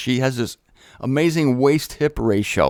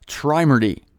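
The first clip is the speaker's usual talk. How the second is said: ordinary talk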